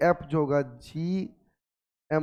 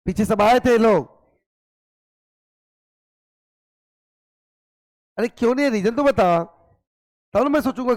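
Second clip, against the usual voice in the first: loud, higher, exasperated questioning; exasperated shout; 5 semitones higher, 6 dB louder than usual. Real, not acted. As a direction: raise your voice at a silent class. Teacher scolds class for absence.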